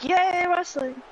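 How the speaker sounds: high, put-on cheer of yay wrestling; Max Blaylock impression. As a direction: mimic Max Blaylock's chant. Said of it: "I'm gonna do a Max Blaylock".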